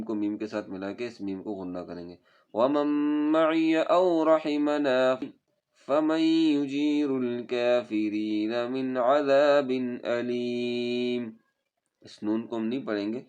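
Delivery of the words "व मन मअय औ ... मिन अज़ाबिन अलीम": melodic chanted tajweed recitation; Quran recitation. Shown as a demonstration. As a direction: recite slowly and musically, stretching vowels.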